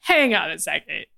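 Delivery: mock suspicion. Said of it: "for the Fantastic Four to be like".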